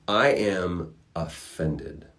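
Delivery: slow, drawn-out "I am offended"; haughty offense. Retold or imitated. Michelangelo to the Pope, slow wounded pride. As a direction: lay out each word with wounded pride. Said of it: "He's like".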